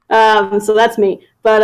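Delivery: ordinary talk, nothing marked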